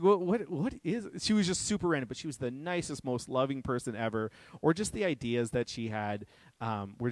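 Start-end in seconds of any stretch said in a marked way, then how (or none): 0.0-1.1 scatterbrained. Retold or imitated. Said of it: "she was just like".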